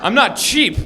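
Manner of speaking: ordinary talk, nothing marked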